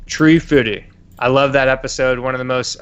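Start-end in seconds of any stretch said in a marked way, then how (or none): 0.1-0.8 South Park Tree Fitty. Retold or imitated. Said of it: "she mentioned Tree Fitty".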